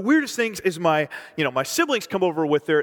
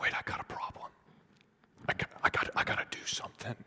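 urgent-sounding inner thought in a whisper; breath-only voice, acting out sudden conviction; whispered realization; 12 dB quieter, faster than usual. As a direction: whisper it haltingly, as if to yourself. Acts out dawning awareness of sin.

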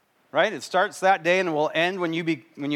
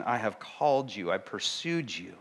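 tender voice of God; 6 semitones lower, 8 dB quieter than usual. Retold or imitated. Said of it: "God is saying".

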